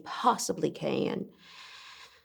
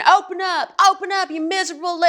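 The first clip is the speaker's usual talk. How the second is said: high, loud, furious yell; screaming; 10 semitones higher, 5 dB louder, much faster than usual. Acted. Drunk father screaming at daughters behind the door. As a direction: scream at them to open the door, fast at the end. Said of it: "then he starts screaming".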